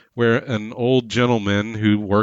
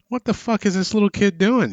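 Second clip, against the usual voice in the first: shocked shopper; 10 semitones higher than usual. Retold or imitated. Shoppers gaping at a rampaging kid.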